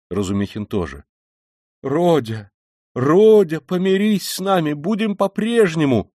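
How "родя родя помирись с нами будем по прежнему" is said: high, loud, desperate plea; imploring cry. A narrator's reading. Poor mother to her departing son, imploring cry. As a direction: call out and beseech, voice soaring. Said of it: "воскликнула бедная мать".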